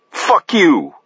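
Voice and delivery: high, blazing retort; words on fire. A narrator's reading. Paris to gunman Jay, words on fire. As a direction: fling it back with burning anger. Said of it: "The words on fire".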